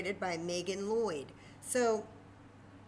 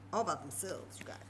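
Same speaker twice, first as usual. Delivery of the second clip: ordinary delivery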